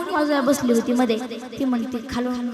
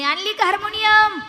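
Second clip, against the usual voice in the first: higher, louder, eager; excited village women asking; eager question; 8 semitones higher, 7 dB louder than usual. Retold or imitated. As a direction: ask eagerly for the harmonium. Women eagerly ask for the harmonium.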